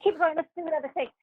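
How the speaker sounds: mock the diva ordering another take; a lightly voiced pushy actress; bossy co-star. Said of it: "She's like".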